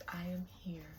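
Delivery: soft, still, small spirit voice. Pretend. The queen's spirit answering the reader, very still and small. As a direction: answer faintly, like a spirit from far away. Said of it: "Very still, small voice".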